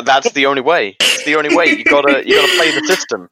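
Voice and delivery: cocky tough talk. Acted. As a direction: sell it like a swaggering rookie. In-character rookie cop talk.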